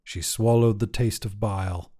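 ordinary delivery

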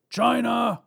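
high, loud call over the shoulder; shouted call. A narrator's reading. Robber calls to his partner across bank, high shout. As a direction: yell for your partner. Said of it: "he shouted over his shoulder".

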